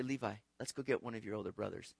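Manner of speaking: put-on low, soft, quick aside to a child; hushed, hurried playful scheming; conspiratorial aside. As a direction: quietly let the kid in on a plan. Dad plotting playfully with his son.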